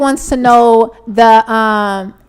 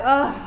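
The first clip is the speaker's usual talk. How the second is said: exasperated mock huff; imitated huff; 3 semitones higher, 4 dB quieter than usual. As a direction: huff like an annoyed ex. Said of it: "why are you huffing and puffing?".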